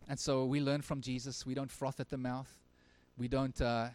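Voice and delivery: ordinary delivery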